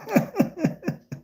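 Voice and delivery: giggling high burst of laughter. Real, not acted. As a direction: let a high laugh escape. Laughs at own story.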